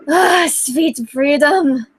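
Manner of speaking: slow and relieved; contented sigh. Acted. Reader relishing school's end, slow contented sigh. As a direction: savour it, slowly. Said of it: "you sighed contentedly".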